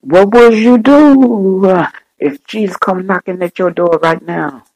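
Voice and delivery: loud, stretched questions pitched high; impassioned preaching. Real, not acted. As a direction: call out the challenge to the congregation. Preacher challenges congregation at full force.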